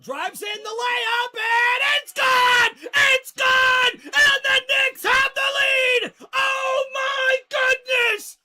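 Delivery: loud, high, drawn-out roar; slow, stretched yells of delight; ecstatic shout. Real, not acted. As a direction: commentator screaming as his team takes the lead. Knicks take the lead.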